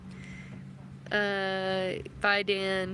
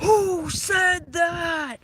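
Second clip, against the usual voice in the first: drawn-out, high, loud "Who said that?"; teacher's retold shout; 10 semitones higher, 8 dB louder, much slower than usual. Teacher demanding to know who yelled the bad phrase, slow loud shout. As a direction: roar it slowly like a stunned teacher. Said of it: "And he goes".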